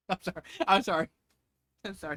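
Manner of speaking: giggling through sorry; real laughter breaking in; laughing apology. Real, not acted. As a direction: apologise while still laughing.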